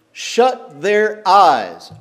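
dragged out very slowly, each word weighted; slow emphatic command. Shown as a demonstration. Preacher driving home God's order, very slow.

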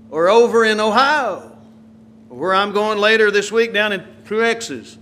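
usual speaking voice

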